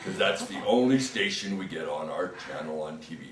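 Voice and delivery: troll voice. Acted. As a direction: chuckle it out as a proud troll. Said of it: "And the troll said".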